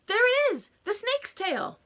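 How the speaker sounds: high, loud shocked scream; frightened sister spotting the snake. A narrator's reading. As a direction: cry out at the sight. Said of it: "Jane screamed".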